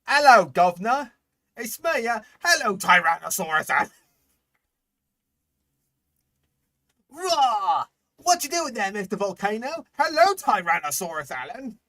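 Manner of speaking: high, loud hammed-up Cockney voice; mock Cockney. Pretending the dinosaur is Cockney, loud high Cockney voice. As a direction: ham up a silly Cockney greeting. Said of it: "'Cause, like, hello?".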